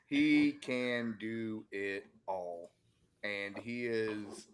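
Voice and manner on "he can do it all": slow, stretched-out words for emphasis; emphatic drawl. Real, not acted. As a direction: draw out every word, savoring the claim. Repeats the claim for emphasis.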